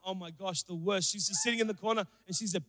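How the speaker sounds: ordinary talk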